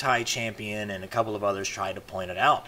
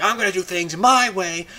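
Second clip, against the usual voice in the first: higher and louder, a defiant boast; mock rogue spy; 7 semitones higher, 5 dB louder than usual. Spy going off on his own, defiant and raised. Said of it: "He was just like".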